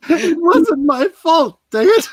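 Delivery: loud slow wailing protest. Acted. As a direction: cry it out, near tears. Hostess wails defensively.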